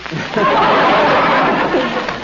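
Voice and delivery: a warm burst of real laughter; hearty laugh. Real, not acted. Husband laughing at his wife's little red hen joke, hearty laugh.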